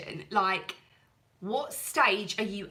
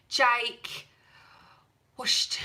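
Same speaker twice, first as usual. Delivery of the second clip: ordinary talk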